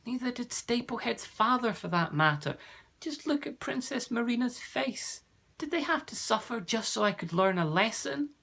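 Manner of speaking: put-on high, boyish voice with a brave edge; a young boy pleading bravely before a mighty being; brave young Jonathan. A narrator's reading. Jonathan questions the mighty warrior, high and earnest. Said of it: "asked Jonathan bravely".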